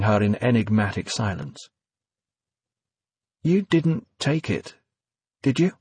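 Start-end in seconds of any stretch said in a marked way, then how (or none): 3.4-5.7 nervous. A narrator's reading. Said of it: "she asked nervously".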